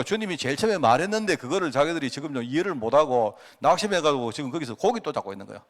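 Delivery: ordinary talk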